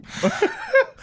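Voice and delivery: shrieking laugh way up high; high squealing laugh. Real, not acted. Storyteller squealing at his own punchline.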